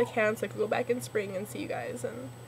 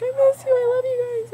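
high, loud mock sob; imitating bawling; 13 semitones higher, 9 dB louder than usual. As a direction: wail it like you're retelling your own bawling. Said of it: "I was, like, totally bawling".

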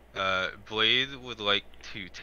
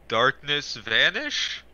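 slow, higher, rising into a question; unsure incantation; 5 semitones higher, 4 dB louder, much slower than usual. Acted. Blade speaking to the shadow trap, unsure incantation. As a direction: try out a spell without knowing if it works. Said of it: "So he's just gonna say".

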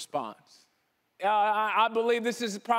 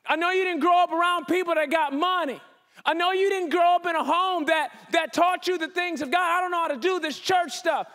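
higher, louder, earnest encouragement; a lightly voiced, fervent God; God reassuring; 6 semitones higher, 4 dB louder than usual. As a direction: lift up the one who feels unqualified. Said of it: "He's going".

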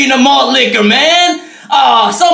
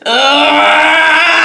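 mock scream; 2 semitones higher than usual. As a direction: let out a hammed-up yell. Bottle pops its top.

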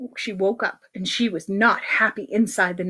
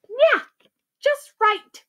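high-pitched approval; satisfied doctor; 12 semitones higher, slower than usual. Acted. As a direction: approve it in a squeakier voice. Doctor approves huge needle.

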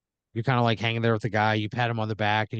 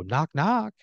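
higher-pitched spoken knock at the door; 6 semitones higher than usual. Acted. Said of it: "you hear a voice go".

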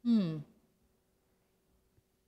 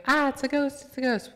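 mock scared cry; 8 semitones higher, 5 dB louder than usual. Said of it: "And still they go".

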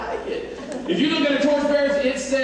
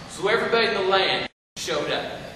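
ordinary talk

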